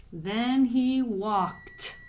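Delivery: drawn-out dictation; slow dictation. Shown as a demonstration. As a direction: stretch each word for spelling. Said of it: "So here's the word walk".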